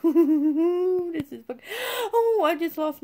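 laughing cry of dismay. Real, not acted. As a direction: wail it while laughing at the loss. Laugh-crying at loss.

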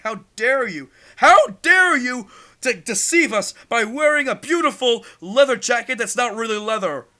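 loud, high hammed-up indignation; mock outrage. Gamer mocking fake leather wearers, hammed-up loud outrage.

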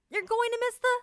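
high, loud rushed warning; a high, loud worried warning; urging dad. Acted. Dad warning his son about missing the party, high and loud.

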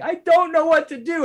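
put-on high, loud, despairing kid wail; wailing child. Dad imitating his sobbing son, high loud wail. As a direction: wail it like a lost, sobbing toddler. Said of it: "it did get pretty sad because he's like".

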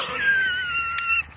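high shrieking laugh. Real, not acted. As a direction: burst out laughing. Laughing hard.

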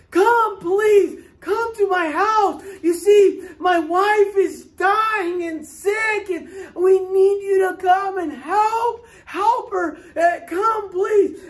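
high, loud begging with a cry in it; loud desperate pleading. Retold or imitated. Man begs Hudson to save his dying wife, loud and desperate.

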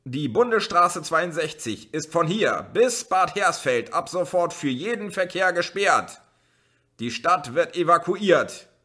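high, loud official bellow; shouting through megaphone. A narrator's reading. Policeman with megaphone shouting at the crowd. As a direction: bark the order at the crowd. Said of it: "hielt ein Megafon vor den Mund und schrie".

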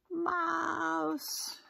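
high, drawn-out cry of alarm; frightened cry. A narrator's reading. Elephant sees Seymour the mouse, frightened cry. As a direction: stretch it out high, like a startled elephant. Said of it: "cries the elephant".

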